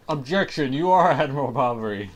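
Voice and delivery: mock objection shout. Player accusing a man of being the admiral, hammed-up shout. Acted courtroom-style objection to denial.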